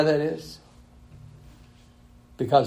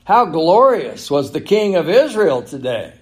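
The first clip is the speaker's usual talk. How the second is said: higher, projected mock admiration; sarcastic; 6 semitones higher, 6 dB louder than usual. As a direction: ring out the false praise with scorn. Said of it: "sarcasm in her voice".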